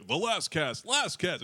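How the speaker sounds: lightly voiced heroic cartoon call; a hint of the Lion-O hero. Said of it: "he did the whole Lion-O voice".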